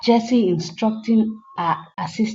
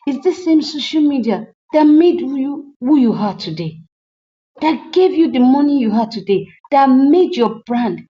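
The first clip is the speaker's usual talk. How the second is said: indignant; 5 semitones higher, 5 dB louder than usual. Retold or imitated. Retold argument about social media.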